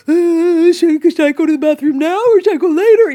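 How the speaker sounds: high, loud mock-needy wail; psychopathically needy. Overly needy wife asking permission, frantic mock neediness. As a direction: dither in hammed-up helpless panic. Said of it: "then there's psychopathic of like".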